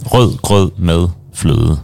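slow, careful "Rød grød med fløde"; slowly demonstrated Danish dessert name; Danish pronunciation. Shown as a demonstration. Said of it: "that's hard for some people to say".

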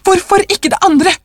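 very high, insistent question; hectic. A narrator's reading. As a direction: demand it feverishly, pitch soaring. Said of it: "sa den gamle konen hektisk".

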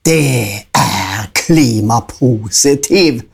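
the big goat answers the troll, unafraid; rough big goat. A narrator's reading. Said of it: "Han var litt grov i målet".

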